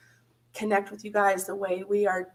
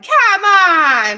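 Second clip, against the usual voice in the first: much louder, high-pitched "come on!"; real, full-voiced cheer; excited shout; 10 semitones higher, 13 dB louder than usual. Real, not acted. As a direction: holler it out in disbelief at the gift. Overjoyed reaction to a donation.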